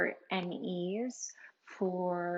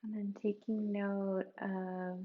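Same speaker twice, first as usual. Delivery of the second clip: guided meditation; 5 dB quieter than usual. Acted. Guide leads listeners through a body scan for pain, softly meditative. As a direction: gently lead them inward, leaving room to breathe.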